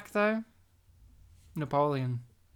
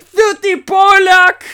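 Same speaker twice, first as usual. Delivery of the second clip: shout; 12 semitones higher, 14 dB louder, much slower than usual. Retold or imitated. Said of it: "he'd be like".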